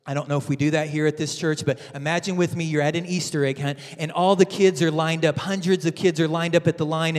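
ordinary talk, nothing marked

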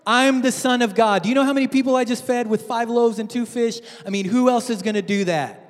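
high, loud, cocky show-off voice; boastful Jesus. Acted. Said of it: "he's saying".

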